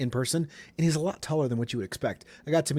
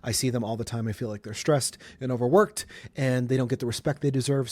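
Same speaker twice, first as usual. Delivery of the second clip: ordinary delivery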